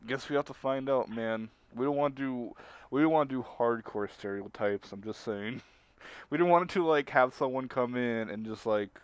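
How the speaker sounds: ordinary talk, nothing marked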